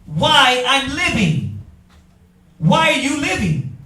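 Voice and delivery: preacher building to the point; emphatic repetition. Acted. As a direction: lean on "Why" and let it hang. Preacher repeating question, rising.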